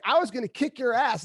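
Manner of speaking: high, loud, jokey tough-guy threat; mock threat. The big man admitting he almost beat up the driver, loud and jokey. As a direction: boast it loud and grinning. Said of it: "he's like".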